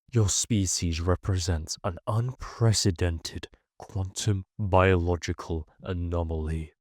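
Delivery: low, slow, steady machine voice; mechanical translator voice. A narrator's reading. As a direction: reverent robotic translation. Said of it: "its mechanical voice steady, but oddly reverent".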